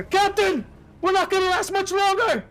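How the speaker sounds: high, urgent sailor's warning; alarmed crew cry. Acted. Crewman warns captain the ship is failing, panicked cry.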